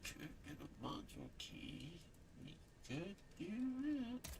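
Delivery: whispered mutter. Real, not acted. Muttering to herself.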